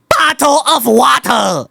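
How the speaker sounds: high, slow mock Chinese accent; exaggerated put-on Chinese accent. Shown as a demonstration. User demonstrates for the AI, Chinese accent. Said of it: "now for the Chinese accent".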